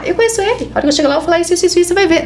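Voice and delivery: imitating a pushy patient. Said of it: "E a pessoa tava ali".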